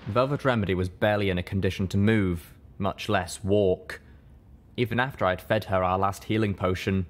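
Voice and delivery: ordinary delivery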